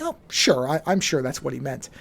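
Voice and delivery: calm, softly spoken agreement; easygoing, unbothered reassurance. Shown as a demonstration. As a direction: say it evenly and low-key, no emphasis. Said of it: "it was something I was calm about".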